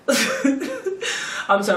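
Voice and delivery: real laugh breaking into an apology. Real, not acted. Apologizing as she thinks back on everything, laughing. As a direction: crack up while saying sorry. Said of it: "I'm gonna start laughing now".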